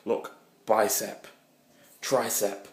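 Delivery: ordinary delivery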